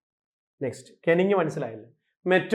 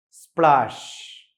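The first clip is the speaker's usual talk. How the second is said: "Splash" slow, with a long aa and sh; imitated splash; 3 semitones lower, much slower than usual. Shown as a demonstration. Teacher imitating a fall into water, drawn-out "splash". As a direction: stretch "splash" like something hitting water. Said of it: "വെള്ളത്തിൽ വീഴുമ്പോൾ ഉള്ള ശബ്ദം".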